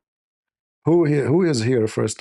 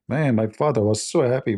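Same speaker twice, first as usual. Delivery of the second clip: normal talking voice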